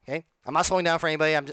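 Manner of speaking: ordinary talk, nothing marked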